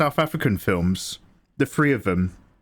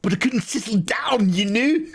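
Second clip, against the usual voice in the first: put-on South African accent; 8 semitones higher than usual. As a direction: play the creepy sleeper agent in a South African accent. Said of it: "That's perfect South African".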